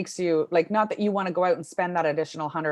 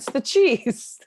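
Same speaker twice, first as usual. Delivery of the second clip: high laughing emphasis; 8 semitones higher, 5 dB louder, slower than usual. Real, not acted. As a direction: laugh through the punchline. Laughing punchline.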